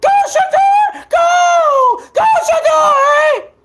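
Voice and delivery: very high, loud, slow screaming cheer; mock fan cheer. Mocking a hater turned cheering fan, screamed high. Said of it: "with your goddamn pom-pom talking about".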